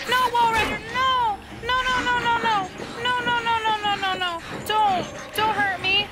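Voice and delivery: high, frantic string of no no no; desperate begging cries while being chased; panicked pleading. Real, not acted. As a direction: beg the monster to stop, in panic. Begging monster chasing him, panicked.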